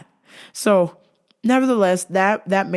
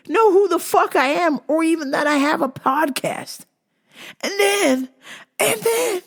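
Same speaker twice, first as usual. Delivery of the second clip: excited; 12 semitones higher, 3 dB louder than usual. Real, not acted. Excited retelling.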